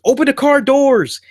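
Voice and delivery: very high, loud yelled order; urgent command. Retold or imitated. Fleeing man yells to his watch, high panicked shout. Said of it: "he's like".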